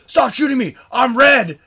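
high, loud mock protest; hammed-up yell to cease fire; retold indignant shout. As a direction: holler like you're being hit by your own side. Shot player protests to teammates.

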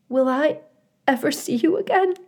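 put-on high, quick, tearful young goose; young goose high and teary, pleading with his mother. A narrator's reading.

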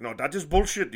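loud, high imitated objection; retold angry island man; indignant outburst. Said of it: "I can't do his island accent".

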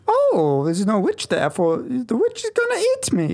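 put-on high childish whine; whiny child. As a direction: beg your dad like a little kid. Skit: scared child.

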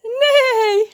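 high, loud cackled word; laughing. A narrator's reading. John denies it to the crow, laughing loudly. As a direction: laugh through the drawn-out word. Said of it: "lacht John".

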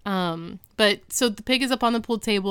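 ordinary talk, nothing marked

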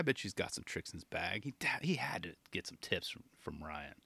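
hushed secret aside; whispering. Real, not acted. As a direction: drop to a whisper. Secret aside about tips.

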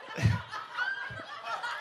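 squeaky laugh breaking through; high giggle. Real, not acted. Pastor cracking up at his impression, high. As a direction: let a high giggle escape.

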